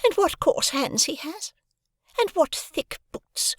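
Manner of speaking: high, slow, sneering whisper; scornful girl, dropping to a whisper; contemptuous. A narrator's reading. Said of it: "Her contempt for me".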